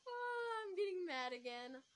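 high cartoonish wail. Narrator breaking off the story, cartoon voice. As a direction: whine it high like a cartoon character. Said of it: "I should be a cartoon voice actor".

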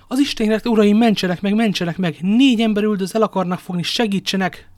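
loud, high cry for help; panicked shouting, high and urgent; desperate plea. A narrator's reading. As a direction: beg frantically to be saved. Terrified merchant bursts in begging help.

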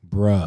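Bruh said with a short uh vowel, not bro. Shown as a demonstration. Friend shows how she said it, Bruh with short uh vowel. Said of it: "B R U H".

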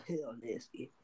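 breathy whispered nasty under a laugh; hushed disgusted aside. Real, not acted. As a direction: mutter it at a whisper, grossed out. Whispered reaction to friend.

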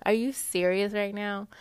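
usual speaking voice

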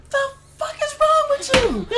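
very high-pitched mock outrage; alarmed best friend. Best friend bursting in on the screaming, high shrill alarm. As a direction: squeal it as a bewildered neighbour. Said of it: "came in and said".

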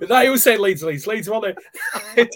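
laughing through the words; laughing tease. Real, not acted. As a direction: crack up while you tease him. Laughing, teasing co-host about chant.

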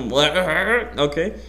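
ordinary talk, nothing marked

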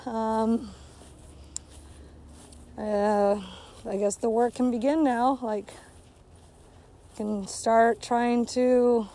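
ordinary delivery

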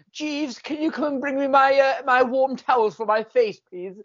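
put-on posh voice, quick and high; mock posh impression. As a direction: summon the butler like a pampered toff. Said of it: "[name] has to do an impression of me".

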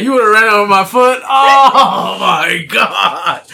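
loud, high-pitched comic outrage; mock outrage. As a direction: blow up at him in exaggerated disbelief.

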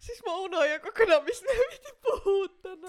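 real laughter, nearly crying; laughing fit. Real, not acted. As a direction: cackle through the sentence. Laughing fit about forgetting topic.

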